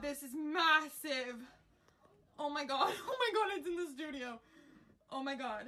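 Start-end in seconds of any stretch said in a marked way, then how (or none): none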